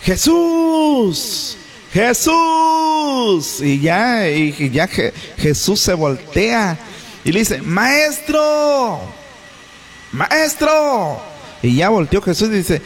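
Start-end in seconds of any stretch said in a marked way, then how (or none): 0.0-3.6 high shouted call from afar. Acted. Lepers calling to Jesus from far away, shouting. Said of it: "le gritaron diciendo". 7.6-11.2 high pleading shout; calling out. Acted. Lepers pleading to Jesus from a distance, shouting.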